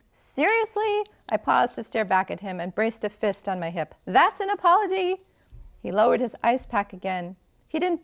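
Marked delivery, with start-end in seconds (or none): 0.1-1.1 high-pitched, incredulous question; affronted disbelief. A narrator's reading. Affronted by his brusque question. 4.0-5.2 high, indignant question; scornful disbelief, voice lifted; incredulous. A narrator's reading. Challenges his poor apology.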